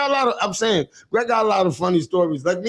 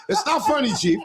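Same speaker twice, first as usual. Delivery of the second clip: ordinary delivery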